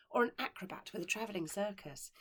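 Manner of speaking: ordinary delivery, nothing marked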